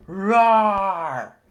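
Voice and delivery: loud lion cub roar; big roar. A narrator's reading. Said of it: "the loudest roar Simba had ever made".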